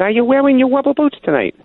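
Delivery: "Are you wearing your rubber boots tonight?" in a high, put-on caller voice, stressing boots; prank caller voice. Caller repeats his rubber boots question to the sportscaster, high mock voice. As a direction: ham up the weird caller as he asks the same question again. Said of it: "He said".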